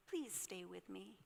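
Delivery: soft, hushed please; quiet plea. Acted. Taylor asking the rabbit to stay, soft hushed plea. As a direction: ask softly, almost under breath.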